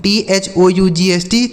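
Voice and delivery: ordinary talk, nothing marked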